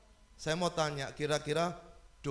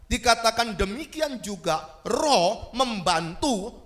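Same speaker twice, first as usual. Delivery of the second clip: loud, high preaching voice, driving each question home; forceful preaching; 7 semitones higher, 8 dB louder than usual. Acted. Preacher pressing the congregation about prayer, loud and forceful.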